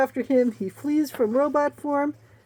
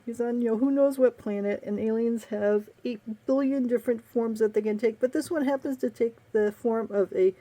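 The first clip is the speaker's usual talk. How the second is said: ordinary talk, nothing marked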